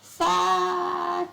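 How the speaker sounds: high, held-out singsong swear; drawn-out high curse. Real, not acted. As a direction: stretch the swear out high and level. Drawn-out, high curse while playing.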